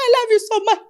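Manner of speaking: high, loud imitating a tearful fan; mock sobbing fan voice, high and loud; crying fan. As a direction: gush like an overwhelmed fan. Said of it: "see someone crying".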